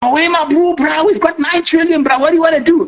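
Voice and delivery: high excited hustler voice; hyped trader. Retold or imitated. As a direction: squeal to your partner about the nine trillion. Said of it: "I think they talk like that".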